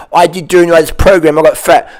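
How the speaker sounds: mock complainer. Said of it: "and go".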